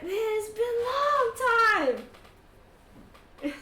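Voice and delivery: high, loud, stretched-out holler; overjoyed reunion shout. Acted. Elf to long-lost comrade, overjoyed shout. Old squadmate thrilled at reunion.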